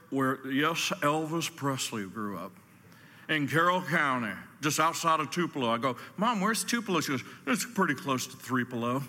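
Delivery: usual speaking voice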